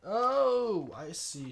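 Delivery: long, high, loud "oh"; drawn-out oh of discovery; the penny drops loudly; sudden realization. Real, not acted. Realizes where the list went.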